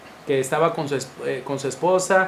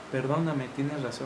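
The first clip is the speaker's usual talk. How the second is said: much softer, humbled apology; humble apology; 3 semitones lower, 9 dB quieter than usual. Retold or imitated. Wife coming back to her husband, quietly admitting he was right. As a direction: give in gently, almost under your breath.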